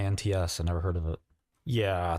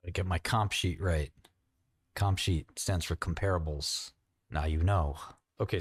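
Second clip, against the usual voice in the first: ordinary talk, nothing marked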